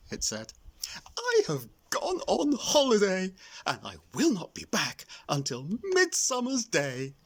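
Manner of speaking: high, bright sing-song fairy voice; cheery fairy note. A narrator's reading. Griselda's holiday notice read out, high sing-song.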